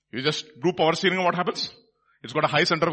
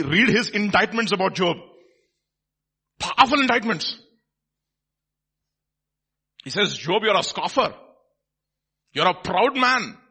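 raised, emphatic pulpit voice; forceful preaching; 4 semitones higher, 6 dB louder than usual. Real, not acted. Preacher telling how Elihu indicted Job, forceful. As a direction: press the indictment home with force.

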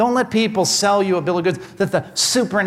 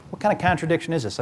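usual speaking voice

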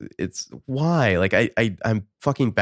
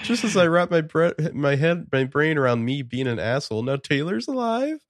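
high, whiny mock sob; hammed-up teary whine; mock crying whine; 8 semitones higher than usual. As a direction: whimper it like a big baby about to cry. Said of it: "He sounds like he's about to cry here".

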